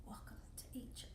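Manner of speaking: soft whispered fast welcome. Retold or imitated. Locals greeting the American, touched whisper. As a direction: breathe it out tenderly.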